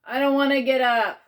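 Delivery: loud, high protesting groan; groaning complaint. A narrator's reading. Child woken by aunt, groaning. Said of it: "I groaned".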